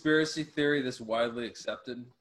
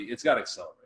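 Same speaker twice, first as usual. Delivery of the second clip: ordinary talk, nothing marked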